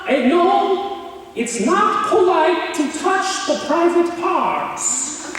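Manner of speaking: high, slow singsong witch voice; old witch teacher. Retold or imitated. Imitating the teacher's awkward remark. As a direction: draw it out high and squeaky. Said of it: "she said".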